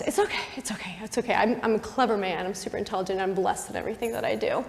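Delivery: soft, private self-soothing as David; softly talking yourself into it; quiet self-reassurance. Acted. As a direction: reassure yourself under your breath. David talking himself into a plan.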